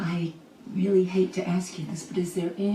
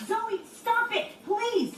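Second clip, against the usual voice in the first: high, slow, pleading order; anxious pleading; 12 semitones higher, much slower than usual. Acted. Adult begging Zoe to stop cutting, anxious pleading. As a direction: beg the child in a high, stretched voice.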